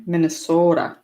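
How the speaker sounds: Minnesota with a long open "so", northern style; Minnesota retried. Shown as a demonstration. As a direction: try Minnesota the way locals say it. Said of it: "Did I do it right that time?".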